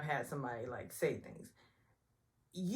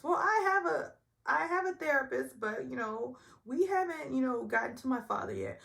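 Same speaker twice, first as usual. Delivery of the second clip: Sonya; 9 semitones higher, 4 dB louder than usual. Retold or imitated. Sonya answering medium.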